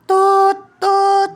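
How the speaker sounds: loud playful tut tut of a train horn; train horn imitation. Child showing what sound a train makes, loud tooting. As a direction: blast it like a toy train horn. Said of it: "Ano yung tunog?".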